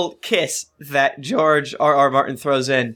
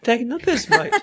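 higher, cheeky British "Taking the piss, mate"; British accent; 9 semitones higher than usual. Acted. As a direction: toss it off like a London lad. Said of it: "That was a good accent, [name]".

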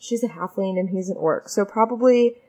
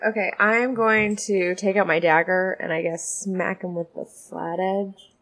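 ordinary talk

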